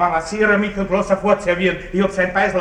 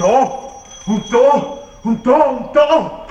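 frantic, higher and louder with each one; panicked pointing; 7 semitones higher, 5 dB louder than usual. Acted. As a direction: point wildly in mounting panic. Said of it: "Nun ist er maßlos geängstigt". (Baron zeigt verängstigt umher, panisch und immer höher)

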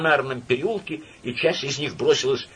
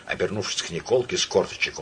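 usual speaking voice

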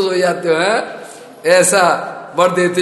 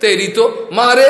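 louder, higher angry outburst; angry threat; 4 semitones higher, 5 dB louder than usual. Acted. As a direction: threaten and lash out. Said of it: "Brahma जी भी गुस्सा हो गया".

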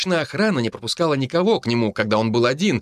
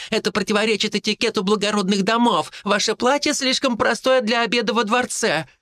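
higher, indignant venting; exasperated young woman; 8 semitones higher than usual. A narrator's reading. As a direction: list every grievance with mounting fury. Mila venting while fighting.

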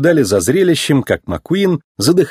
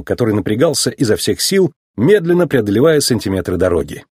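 ordinary delivery, nothing marked

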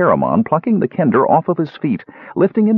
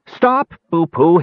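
high, drawn-out urgent call; frantic cry; 8 semitones higher, 4 dB louder, slower than usual. A narrator's reading. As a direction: call desperately after someone leaving. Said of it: "he cried frantically".

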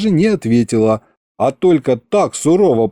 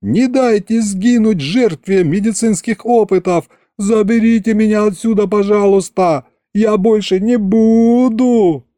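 pleading whine; 8 semitones higher than usual. Acted. Patient begs the king to rescue him, high and pleading. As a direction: beg the king like a naughty child.

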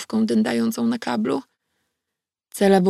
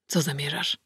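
softly, lower, barely voiced; quiet question; 4 semitones lower, 8 dB quieter than usual. A narrator's reading. Bjorn asks her quietly about her plan, softly. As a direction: ask it quietly, holding back. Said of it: "zapytał cicho Bjorn".

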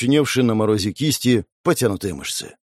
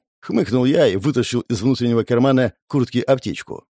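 plain reading voice

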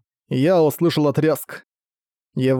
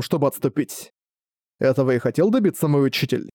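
ordinary talk

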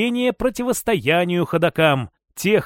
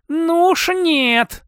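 high, drawn-out growl of refusal; defiant growl, stretched long; 10 semitones higher, much slower than usual. A narrator's reading. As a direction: growl it out slowly, pitched up. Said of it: "рыкнула она".

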